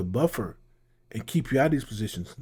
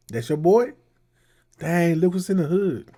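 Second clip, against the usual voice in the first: higher, louder mock of the men in the clip; mimicking the clip; 6 semitones higher, 6 dB louder than usual. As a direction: parrot their excited remarks, pitched up and loud.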